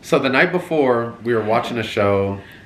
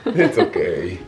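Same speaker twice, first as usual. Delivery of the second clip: ordinary talk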